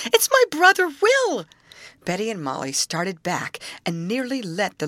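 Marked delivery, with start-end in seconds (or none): 0.1-1.5 high, loud near-shriek of recognition; half-hysterical scream. Acted. Sister recognizing her lost brother, half-hysterical scream. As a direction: scream it out, almost losing control. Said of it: "she screamed, half hysterically".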